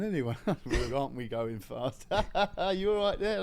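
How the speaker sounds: mock the jolly paramedic; hammed-up chatty medic; chirpy paramedic. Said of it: "he's going".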